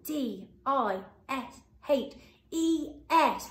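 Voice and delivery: C A T F I S H, slow, letter by letter; spelling out letters. Shown as a demonstration. Teacher spells catfish for children to check. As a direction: spell it out slowly. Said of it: "Let's have a look".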